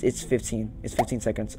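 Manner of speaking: usual speaking voice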